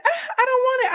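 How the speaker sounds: high yelped ah; mock scream. Wife reenacting her faked scream, high. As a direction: fake a scream of fright. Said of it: "I screamed".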